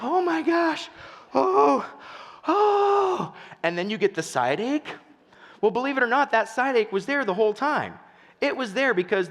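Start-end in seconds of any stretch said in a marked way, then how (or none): 0.0-3.3 high, loud, hammed-up gasping moans; mock laughed-out breathlessness. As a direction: act out aching sides after laughing. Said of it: "holding your side".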